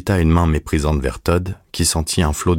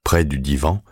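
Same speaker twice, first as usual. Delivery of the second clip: ordinary delivery, nothing marked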